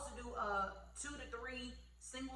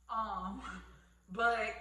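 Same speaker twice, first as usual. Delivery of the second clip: normal talking voice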